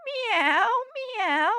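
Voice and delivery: high meowing; cat meow. Acted. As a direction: mew like a puzzled cat. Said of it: "said the cow".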